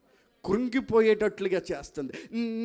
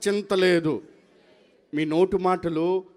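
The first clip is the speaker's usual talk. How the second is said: with faith; 7 dB louder, slower than usual. Shown as a demonstration. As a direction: declare it firmly as a believer. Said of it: "విశ్వాసంతో పలకండి". (విశ్వాసంతో గట్టిగా)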